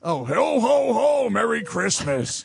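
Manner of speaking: booming jolly Santa laugh; slow, hearty Santa voice. Pretend. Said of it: "what's your Santa voice?".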